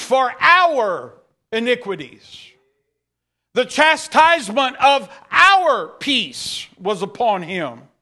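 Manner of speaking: rising sermon climax, pressing every phrase; preaching at full force. Acted. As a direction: drive it home like a revival preacher.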